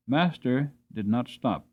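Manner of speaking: ordinary delivery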